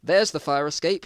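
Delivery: loud and high, calling out; shouted. Acted. Mike calling to his friend, shouting about the fire escape. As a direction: shout it across the room. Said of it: "shouted Mike behind him".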